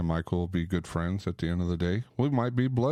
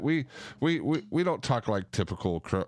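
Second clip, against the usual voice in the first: normal talking voice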